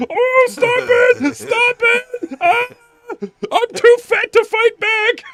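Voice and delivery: high, slow squealing beg; mock whiny plea. Tommy Dreamer begs while beaten, in a high squeal.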